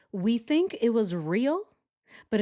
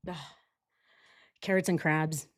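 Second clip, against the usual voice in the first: usual speaking voice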